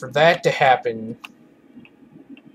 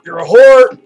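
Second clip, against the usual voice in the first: frustrated yelling; 8 semitones higher, 7 dB louder than usual. Real, not acted. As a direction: rant at the screen. Raging at the jumping enemy.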